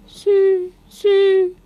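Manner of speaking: high, piping insect-like notes, not whispered; timid dragonfly call. A narrator's reading. Starling trying out a dragonfly's song for Babette, timid piping. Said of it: "dann flüsterte er zaghaft".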